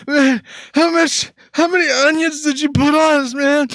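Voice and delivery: high, strained wail, imitating a man in tears; mock choking friend. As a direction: imitate gasping outrage through onion tears. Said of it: "like, having breathing problems".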